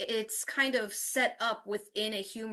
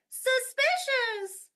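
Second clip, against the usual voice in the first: very high, loud, stretched "suspicious"; changed silly tone; 6 dB louder, much slower than usual. Shown as a demonstration. Teacher making students copy a silly tone, high and drawn out. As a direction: sing out "suspicious" on one high held pitch. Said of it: "I'll even change the tone".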